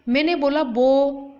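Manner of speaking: बो- drawn out with rounded lips; drawn-out syllable. Shown as a demonstration. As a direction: stretch बो- so the class hears the ओ. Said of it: "बो में मेरा मुंह गोल हुआ".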